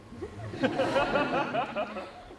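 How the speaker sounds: high smug chuckle; mocking laugh. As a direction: laugh down at her. Condescending laugh.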